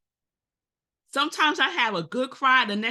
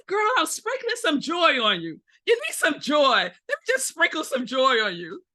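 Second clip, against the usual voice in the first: higher, playful sass; playful sassy girl; 6 semitones higher than usual. Retold or imitated. Telling a gloomy friend off, lightly sassy and playful. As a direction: tease her like a fairy sprinkling glitter. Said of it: "I said".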